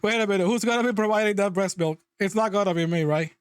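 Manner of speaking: ordinary talk, nothing marked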